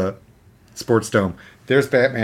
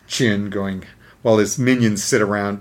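normal talking voice

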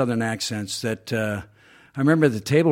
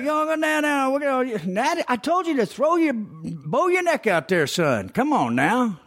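put-on high Southern coach hollering at his boy; high-pitched Southerner; 14 semitones higher than usual. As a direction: belt it high like a Southern football dad. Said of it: "one of those high-pitched Southerners".

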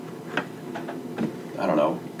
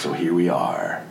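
ordinary talk, nothing marked

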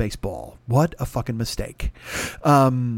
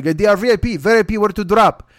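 raised, louder Russian-driver impression; mock Russian accent; 6 semitones higher, 6 dB louder than usual. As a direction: insist in a rough Russian accent. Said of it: "I don't do accents".

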